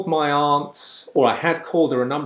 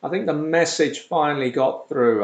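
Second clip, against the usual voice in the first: usual speaking voice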